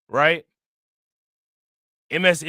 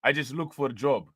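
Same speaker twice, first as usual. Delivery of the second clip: put-on light Russian accent, quiet; lightly imitating a Russian accent; 3 semitones lower, 4 dB quieter than usual. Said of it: "he said in a Russian accent".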